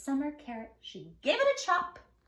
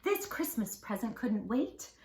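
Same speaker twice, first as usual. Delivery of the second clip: ordinary delivery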